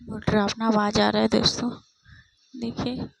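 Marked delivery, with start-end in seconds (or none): none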